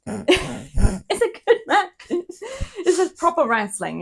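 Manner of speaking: high, slow words through giggles; laughing. Real, not acted. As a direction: giggle your way through the praise. Laughing while praising the match.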